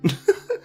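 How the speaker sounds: sharp high laugh. Real, not acted. Keeps laughing at the clip.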